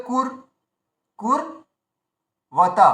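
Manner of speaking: কুর held long, silence, then ওয়াতা; drawn-out, then pause. Shown as a demonstration. Teacher shows the joined consonant pulled back, কুর held apart from ওয়াতা. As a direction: stretch কুর and break before ওয়াতা. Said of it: "রটাকে টেনে নিতে হবে".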